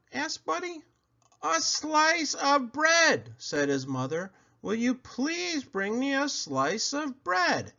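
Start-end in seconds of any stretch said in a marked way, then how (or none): 1.4-7.7 much higher, slow and deliberate; patient mother repeating. Acted. Mother repeating her request slowly to Buddy. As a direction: spell it out for a dreamy child.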